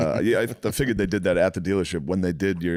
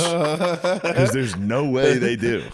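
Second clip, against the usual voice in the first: slow, higher, laughing through the words; laughing disbelief; 5 semitones higher, much slower than usual. Real, not acted. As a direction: crack up at how absurd it is.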